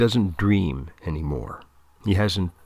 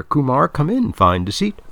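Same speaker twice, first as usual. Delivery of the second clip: upbeat counselor; 6 semitones higher than usual. A narrator's reading. Counselor welcomes student.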